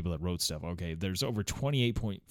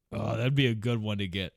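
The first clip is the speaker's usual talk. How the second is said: ordinary talk, nothing marked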